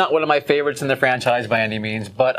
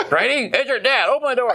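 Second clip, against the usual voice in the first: higher pitched mock yell; hammed-up shouting father; mock yelling dad; 9 semitones higher than usual. Imitating the screaming dad.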